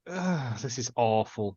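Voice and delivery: low, slow and deflated; weary groan. Retold or imitated. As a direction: sigh it out, fed up. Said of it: "I'm like".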